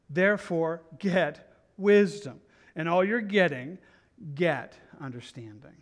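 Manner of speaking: loud, slow, deliberate verse reading; emphatic scripture reading. A narrator's reading. Preacher reads the key verse to the congregation, loud and emphatic. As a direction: proclaim each phrase with weight and pauses.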